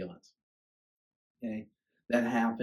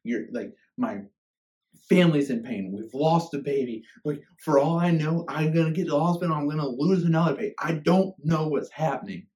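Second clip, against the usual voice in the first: louder, higher anguished plea; desperate cry to God; 6 semitones higher, 9 dB louder than usual. Retold or imitated. Father crying out to God on way to hospital, anguished. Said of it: "And I was like".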